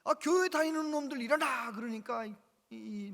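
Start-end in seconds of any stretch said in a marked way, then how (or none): 0.0-1.7 higher, louder barked order; stern teacher shouting the order; teacher's command. Retold or imitated. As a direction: bark it at the class again. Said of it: "아무튼".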